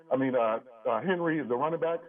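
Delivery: normal talking voice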